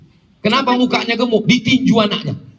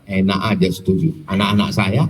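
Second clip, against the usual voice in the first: low, fast grumbling parent; mock sulky retort; 12 semitones lower, faster than usual. (gerutu orang tua yang enggan, suara rendah)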